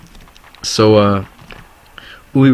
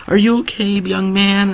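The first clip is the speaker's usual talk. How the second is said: much higher-pitched mock lady voice; concerned lady; 11 semitones higher, slower than usual. Lady pulls over to check on him, imitated high voice. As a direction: imitate a passing lady in falsetto. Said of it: "This one lady pulled over".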